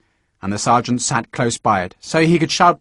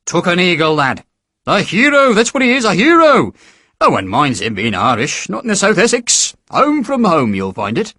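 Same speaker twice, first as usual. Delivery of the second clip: higher, lightly voiced hearty sergeant; jovial recruiting sergeant; 6 semitones higher, 3 dB louder than usual. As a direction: charm the lad into signing up. Sergeant praising hero.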